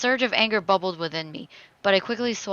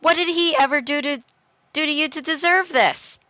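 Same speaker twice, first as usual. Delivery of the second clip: high, loud accusing question; angry outburst; 7 semitones higher, 5 dB louder than usual. Acted. Daughter defends grandfather to her father, angry. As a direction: demand an answer, voice raised.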